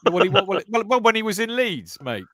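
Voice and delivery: lightly put-on Yorkshire manager voice; Brian Clough accent. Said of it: "didn't develop the accent that quickly".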